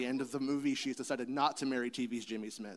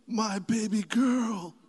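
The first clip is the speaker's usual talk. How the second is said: high, slow tender cry; emotional proclamation; 9 semitones higher, 6 dB louder, much slower than usual. Pretend. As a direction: drop to knees, overcome. Luke proclaiming.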